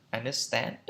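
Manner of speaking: understand swung upward on the final syllable; questioning rise at the end of understand; rising intonation. Shown as a demonstration. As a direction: lift STAND in understand like a question. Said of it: "lên giọng".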